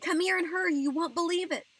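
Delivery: high, loud, urgent call; excited shout. A narrator's reading. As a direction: shout for someone to hurry over. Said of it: "I shouted".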